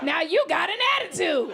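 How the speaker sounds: high, loud, aggrieved laughing protest; whiny harasser. Retold or imitated. Comic mimics a harasser defending himself, loud whine. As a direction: play the offended creep. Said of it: "those are the men that usually sexually harass".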